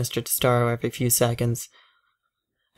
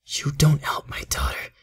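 whisper; 3 semitones higher than usual. Acted. Father's hissed threat.

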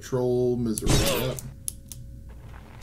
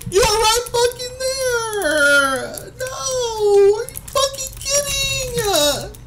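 very high, slow wailing half cry half laugh; 7 dB louder, slower than usual. Real, not acted. Gamer wailing in disbelief, stuck right at the goal. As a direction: break down wailing at the screen.

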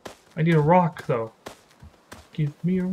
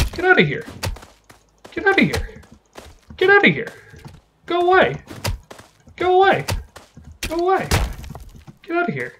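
shouting; 10 semitones higher, faster than usual. Real, not acted. Chasing mobs away in game.

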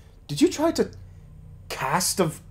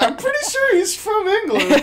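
higher, slower, with a laugh breaking in; laughing through a retold quote; 4 dB louder than usual. Retelling her mix-up to her co-player, laughing. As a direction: giggle while repeating what you said. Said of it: "I was like".

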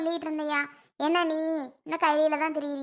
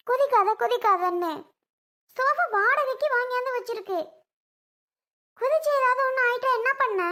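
higher-pitched, fed-up telling-off; exasperated scolding; 4 semitones higher than usual. Acted. Aunt telling Ammu off for jumping on the rented sofa, exasperated. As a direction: scold a child who will not listen.

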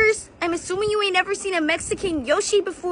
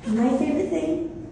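ad read; 5 semitones lower, 7 dB quieter than usual. Pretend. Ad read for Mexican Coke.

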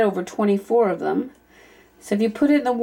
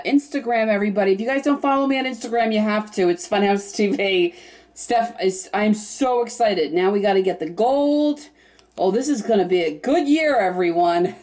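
usual speaking voice

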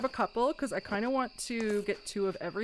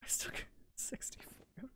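whisper; 2 semitones higher, 14 dB quieter than usual. Real, not acted. Muttering stats to herself.

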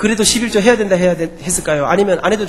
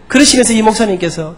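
normal talking voice

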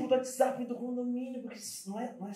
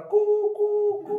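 busy tone; 8 semitones higher, 4 dB louder, much slower than usual. Retold or imitated. Hang-up tone pun.

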